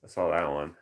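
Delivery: usual speaking voice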